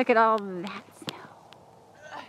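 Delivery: slow awed whisper. Real, not acted. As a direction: breathe it out slowly in awe. Awed at the mountains.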